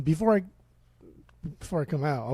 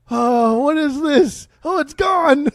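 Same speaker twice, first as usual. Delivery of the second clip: much higher, louder, slow confused wail; bewildered Ozzy; 10 semitones higher, 6 dB louder, much slower than usual. Retold or imitated. Ozzy realising the tablet dissolved, high bewildered wail. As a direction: gasp in amazement, very high.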